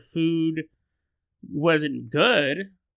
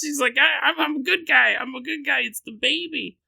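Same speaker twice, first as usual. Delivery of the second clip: high pleading panicked voice; screaming woman; 9 semitones higher than usual. Acted. Natalie begging the orderly, high pleading shriek. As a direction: beg not to be left alone, shrieking. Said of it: "she's screaming".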